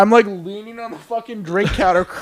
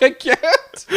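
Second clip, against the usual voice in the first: squeaky high laugh through words; laughing too hard to speak; 13 semitones higher than usual. Retold or imitated. Overcome with laughter.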